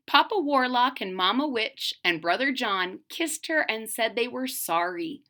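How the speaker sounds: ordinary delivery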